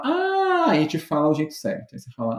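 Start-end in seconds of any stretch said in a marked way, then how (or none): 0.0-0.8 mock surprised ah. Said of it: "e depois ela fala".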